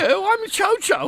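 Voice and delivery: high, put-on snooty dog greeting; posh dog voice. Radio co-host voices a posh dog for laughs, high put-on voice. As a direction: play the posh dog saying hello. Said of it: "A, a very posh dog".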